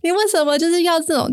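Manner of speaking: 你为什么 yelled high, furious and accusing; angry scolding. Retold or imitated. Daughter scolding her dad in the rain, angry. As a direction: scold him hard. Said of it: "我就在街上飙骂他".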